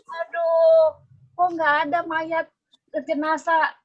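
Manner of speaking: Mary Magdalene weeping at the empty tomb, distraught. A narrator's reading. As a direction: wail it like a grieving woman at the tomb. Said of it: "gitu ya, nangis-nangis ya".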